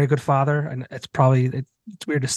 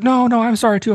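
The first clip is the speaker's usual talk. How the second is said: higher, louder apologetic woman; imitating the woman; 9 semitones higher, 5 dB louder than usual. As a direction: quickly apologise back, mock-high. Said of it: "She's like".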